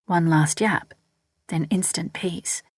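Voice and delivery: usual speaking voice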